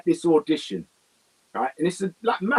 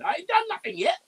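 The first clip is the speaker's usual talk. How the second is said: panicky protest; 4 dB quieter than usual. Retold or imitated.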